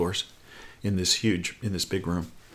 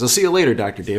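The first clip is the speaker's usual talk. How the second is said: called-out farewell; 6 dB louder, faster than usual. Retold or imitated. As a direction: call out a goodbye, dropping on the name. Repeats the acted parting.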